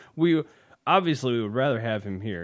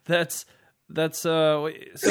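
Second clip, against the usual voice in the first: ordinary talk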